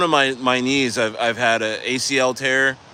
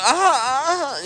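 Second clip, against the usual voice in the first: mock scream; 13 semitones higher, slower than usual. Client recalling the knuckles driven in, high mock scream. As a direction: mimic your own pained cry, high. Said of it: "I was actually, like, screaming".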